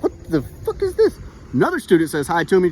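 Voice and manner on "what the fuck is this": high-pitched, incredulous reaction; baffled disbelief. Retold or imitated. Student reacting to a classmate who barks, incredulous. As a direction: squeak it out in bewildered disbelief.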